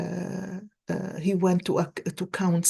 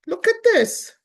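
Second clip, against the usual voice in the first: excited; 12 semitones higher, 7 dB louder than usual. Real, not acted. Excited at card drawn.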